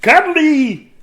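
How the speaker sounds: very high, loud, drawn-out cry; joyful shout. A narrator's reading. Dudley answering the shy penguin, high joyful shout. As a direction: shout it with delight. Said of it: "shouted Dudley".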